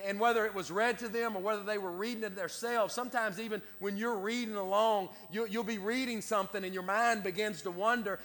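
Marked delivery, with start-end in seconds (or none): none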